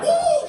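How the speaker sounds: loud high whoop; mock woo. Comic to the audience, cheering like a drunk pub crowd.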